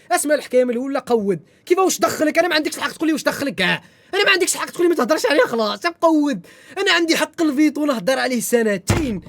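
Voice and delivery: loud, high angry rant at a commenter; angry outburst. Real, not acted. Streamer shouting back at a viewer's comment, furious. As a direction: tear into the viewer who questioned him.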